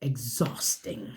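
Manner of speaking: soft, slow whisper; slow exhausted whisper. Real, not acted. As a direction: breathe the word out slowly. Preacher whispers the word.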